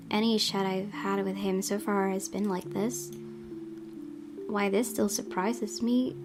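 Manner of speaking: ordinary delivery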